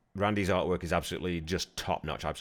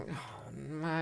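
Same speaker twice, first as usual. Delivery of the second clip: exasperated scoff, pitched up; mock disbelief; 8 semitones higher, 9 dB quieter than usual. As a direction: roll your eyes at the star's cliché. Mocking the rock star's claim.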